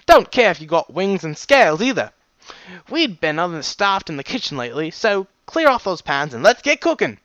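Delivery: hearty cook in a put-on accent; brisk, accented pony giving orders; firm stallion cook. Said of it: "he firmly stated".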